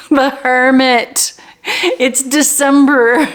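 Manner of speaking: high, drawn-out, amused delivery; big slow playful announcement; playful emphasis. Real, not acted. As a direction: stretch it out high and loud, tickled by it. Playful repeat of the archetype, after laughing.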